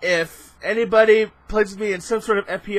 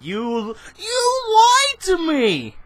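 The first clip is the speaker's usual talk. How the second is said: much higher, slow outraged wail; mock betrayed outcry; 7 semitones higher, much slower than usual. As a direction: drag it out like a hammed-up betrayal. Game level lied to him.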